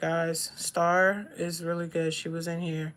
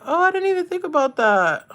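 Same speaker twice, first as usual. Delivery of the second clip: high and loud, caught off guard; a sudden oh of discovery; surprised realization; 9 semitones higher, 5 dB louder, faster than usual. Real, not acted. Sudden realization about Wicked.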